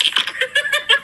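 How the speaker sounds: high, bright burst of laughing; burst of laughter. Real, not acted. Friend cracks up at the cop encounter, laughing.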